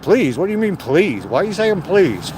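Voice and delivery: mock the puzzled clerk's disbelief; incredulous retort. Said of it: "He said".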